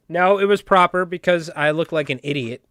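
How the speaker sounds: ordinary talk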